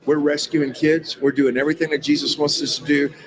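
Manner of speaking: ordinary talk, nothing marked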